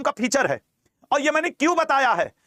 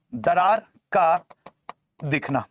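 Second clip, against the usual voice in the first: very slow, word by word, dropping lower at the end; slow dictation; 5 semitones lower, slower than usual. Real, not acted. Teacher repeating an exam example for students, slow dictation. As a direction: spell the phrase out slowly for note-takers.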